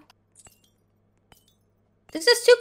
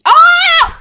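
startled shout; 11 semitones higher, 7 dB louder than usual. Real, not acted. Dies in game again.

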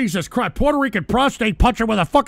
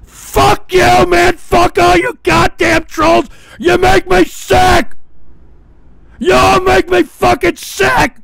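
much louder, higher, slower screaming; furious shout; 6 semitones higher, 9 dB louder, slower than usual. Real, not acted. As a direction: scream it at the whole chat, disgusted. Screaming at trolls in chat.

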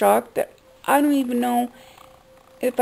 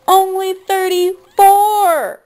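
high, loud, slow outcry, voice straining; anguished cry; 10 semitones higher, 7 dB louder, slower than usual. Real, not acted. Vlogger crying out that the dead singer was so young, anguished shout. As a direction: wail it out in real disbelief. Repeats age in outrage.

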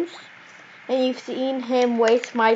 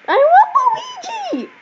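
very high, drawn-out squeal; whiny demand; slower than usual. Real, not acted. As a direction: demand it in a long, high whine. Playful demand for a game character.

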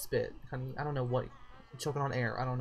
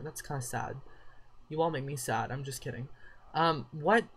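normal talking voice

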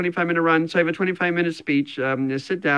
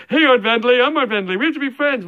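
lightly acted, higher-pitched Art Vandelay; acted eager greeting; 8 semitones higher, 4 dB louder, faster than usual. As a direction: playfully act out an eager greeting. Acted greeting in imagined dialogue.

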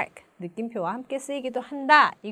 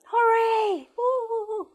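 high-pitched hooray trailing into woo-hoo; cheer, then whoop along; celebratory; 11 semitones higher, much slower than usual. Shown as a demonstration. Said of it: "뭔가 축하하고 싶은 그런 기분". (축하하는 신난 환호)

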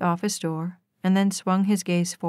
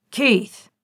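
sharp, loud angry bark of a name; harsh and sharp; 4 semitones higher, 5 dB louder than usual. A narrator's reading. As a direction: snap the name like a command. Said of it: "The name was harsh and sharp".

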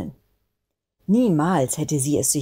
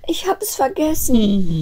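put-on high, drawn-out childlike wail; sobbing; 9 semitones higher, 4 dB louder, slower than usual. A narrator's reading. Snowflake confesses to having no landing place, sobbing. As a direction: wail it tearfully in a little voice. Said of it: "schluchzte sie".

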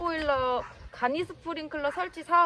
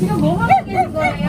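loud call to the worker; yelling across the room; 2 semitones higher, 9 dB louder than usual. Real, not acted. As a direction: raise your voice over the noise. Asking worker over construction noise.

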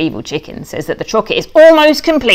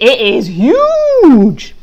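awed; 5 semitones higher, 3 dB louder, much slower than usual. Acted. Pig marvels at poo heap.

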